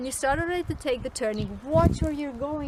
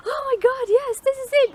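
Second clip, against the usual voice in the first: excited; 13 semitones higher, 5 dB louder than usual. Retold or imitated. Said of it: "I was like".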